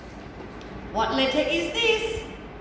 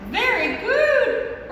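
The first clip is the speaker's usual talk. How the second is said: high, slow, delighted praise; excited praise; 10 semitones higher, 5 dB louder, much slower than usual. Acted. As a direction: gush over the puppet's answer. Praising puppet warmly.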